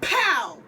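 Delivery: a short loud pow; mock gunshot. Retelling the episode, mock gunshot pow. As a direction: punch out a gunshot sound.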